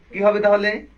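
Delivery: ordinary talk, nothing marked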